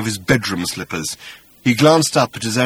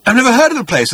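high, emphatic denial; insistent; 12 semitones higher, 5 dB louder, much faster than usual. Acted. Arthur denying he knows the place, insistent. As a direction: insist on innocence, voice raised. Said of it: "insisted Arthur".

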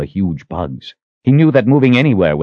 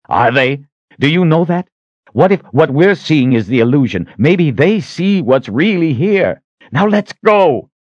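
high, challenging hero's argument; Richard, urgent; 9 semitones higher, 4 dB louder than usual. A narrator's reading. Richard challenging the Sister about the visions, urgent. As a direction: build from pressing questions to a loud command.

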